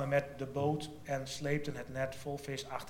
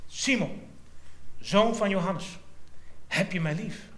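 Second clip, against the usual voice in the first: a raised, earnest call of the name, then the question; tender question; 5 semitones higher than usual. Acted. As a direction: ask it searchingly. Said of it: "sprak Jezus Simon Petrus aan". (zacht indringend gevraagd)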